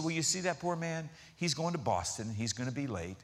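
soft, low, unruffled reply; calm agent. Retold or imitated. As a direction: imitate a serenely composed airline clerk, quietly. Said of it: "just so calm".

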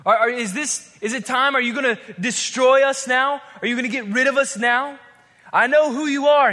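loud, high, stammering alarm; panicking demon. Acted. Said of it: "the demon can't help but go".

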